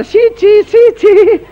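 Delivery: very high, drawn-out cries of disgust; disgusted shooing. Acted. Father rejecting his child from afar, high disgusted shooing. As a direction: shoo it away in a high squeal.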